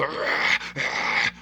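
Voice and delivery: rough, voiceless aggressive growl; imitated growl. Showing the neighbour turning aggressive, imitated growling. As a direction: growl it out like the man outside. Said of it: "the dude growling".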